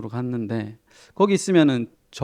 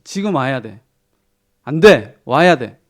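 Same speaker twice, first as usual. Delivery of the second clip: loud, insistent phone demand; a dad shouting at his son on the phone; 9 dB louder, slower than usual. Retold or imitated. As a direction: hammer it home, loud. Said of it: "큰 목소리로".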